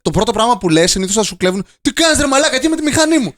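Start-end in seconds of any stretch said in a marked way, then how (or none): 1.8-3.3 high, rough "Τι κάνεις, ρε μαλάκα" thrown at a thief; mock-tough challenge to a bike thief; rude confrontation. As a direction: call the thief out crudely and much higher.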